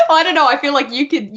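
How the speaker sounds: laughing, high-pitched and quick; loud, high, amused deflection. Real, not acted. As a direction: burst out laughing as you answer. Laughs off question to colleague.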